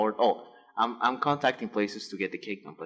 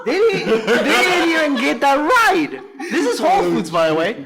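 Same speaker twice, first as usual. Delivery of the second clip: high, loud, nearly crying with laughter; can barely get the words out through the laughter; laughing to tears; 11 dB louder than usual. Real, not acted. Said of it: "laughing till near tears".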